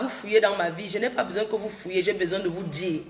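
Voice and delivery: ordinary talk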